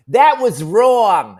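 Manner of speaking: slow, high, loud disapproving drawl; loud, slow, high indignant scolding; scolding lady. Retold or imitated. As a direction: stretch it out like a prim matron. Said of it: "a lady goes".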